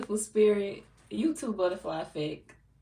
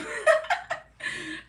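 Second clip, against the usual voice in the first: high-pitched laughing burst; high, rolling laughter; real laugh. Real, not acted. Laughs after telling off troll.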